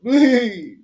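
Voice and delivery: high, loud whiny plea; hammed-up falsetto begging; mocking the beggar. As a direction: mimic a terrified man begging. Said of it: "Look at him".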